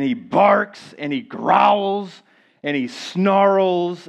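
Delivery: verbs drawn out slowly; mock ferocious. Preacher describing his dog facing a stranger, mock ferocious and drawn out. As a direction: stretch each fierce verb, hammed-up. Mimics dog's fierceness in words.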